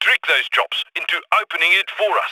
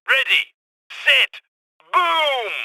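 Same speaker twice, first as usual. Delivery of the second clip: high countdown climbing to the last word; gleeful countdown, last word drawn out; excited countdown; 7 semitones higher, much slower than usual. Acted. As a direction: count it off higher each time. Counting down to a blast.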